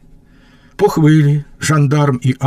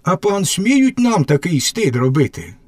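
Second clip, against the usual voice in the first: bold and defiant, pitched higher; bolder retort; 7 semitones higher than usual. Acted. Young lad boldly answers the gendarme, defiant. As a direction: throw it back at him, unafraid. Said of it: "Парубок сміліше".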